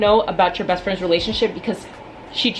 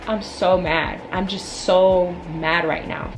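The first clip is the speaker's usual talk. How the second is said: ordinary talk, nothing marked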